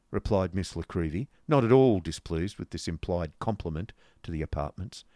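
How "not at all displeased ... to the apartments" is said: ordinary delivery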